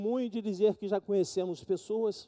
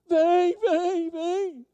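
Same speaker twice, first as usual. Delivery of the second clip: much higher, louder, eager come; mock-tempted beckoning; eager giving in; 10 semitones higher, 6 dB louder, much slower than usual. (jovem cedendo à tentação, bem mais agudo e alto)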